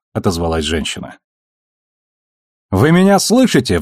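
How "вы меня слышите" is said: выше, громче, вспылив; резко сорваться на крик; взрываясь. A narrator's reading. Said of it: "взорвался Холден". (вспылил)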